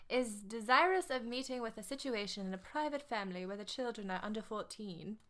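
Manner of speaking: put-on genteel English accent, read out as the ad; a prim English accent on the ad copy. Reading Jane's classified ad to the listeners in an English accent. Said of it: "her ad was, um, in an English accent".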